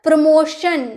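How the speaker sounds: प्रमोशन, ending "shun", neither "sun" nor "tun"; correct SH pronunciation. Shown as a demonstration. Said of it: "प्रमोशन नहीं या प्रमोटन नहीं".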